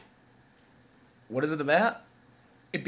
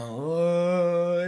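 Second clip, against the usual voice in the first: drawn-out, higher, louder eerie moan; creepy voice; 4 semitones higher, 7 dB louder than usual. Retold or imitated. Imitating the film's opening voice that scared them, creepy wail. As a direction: imitate a creepy film voice with one long "oh". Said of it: "that creepy voice going".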